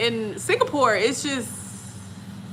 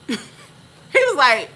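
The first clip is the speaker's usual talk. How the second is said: normal talking voice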